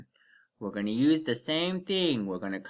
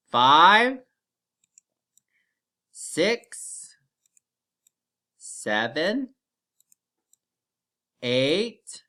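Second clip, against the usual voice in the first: teacher counting aloud for class; slow counting; 5 dB louder, much slower than usual. Shown as a demonstration. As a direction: count slowly with pauses. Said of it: "Let's count with me".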